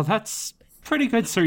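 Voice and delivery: ordinary delivery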